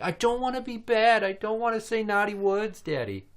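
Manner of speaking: put-on high, anxious little-kid voice; imitated little girl. Daughter telling daddy she won't swear, high mock child voice. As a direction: mimic a child pleading not to be naughty.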